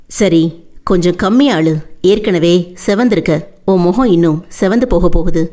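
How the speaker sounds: low voice for the man, gently chiding; Veerapandi, calming. Acted.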